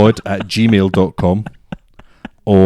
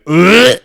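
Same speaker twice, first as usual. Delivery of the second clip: high, loud acted "ugh"; disgusted noise; 11 semitones higher, 7 dB louder than usual. Recounting being spat on, mock disgusted yelp. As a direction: blurt a revolted groan. Acting his reaction to spit.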